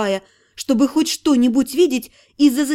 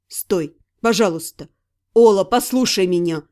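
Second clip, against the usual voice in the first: lower, urgent persuading; pleading man; 7 semitones lower than usual. Acted. Ирга holds her back, lower, pleading. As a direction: coax her to stop. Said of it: "уговаривал Ирга".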